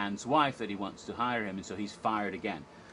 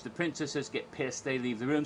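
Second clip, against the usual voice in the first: usual speaking voice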